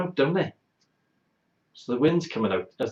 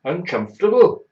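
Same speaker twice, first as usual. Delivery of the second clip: much higher, loud disbelieving rise; incredulous echo; 7 semitones higher, 9 dB louder than usual. Real, not acted. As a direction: throw the word back in disbelief.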